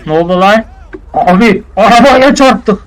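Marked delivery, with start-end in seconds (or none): 1.1-2.8 high, loud panicked yell, slowed. Acted. Sidekick yells to his boss that they hit a car, panicked yell. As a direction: blurt out the crash in a panic. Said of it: "bağırma lan o kadar".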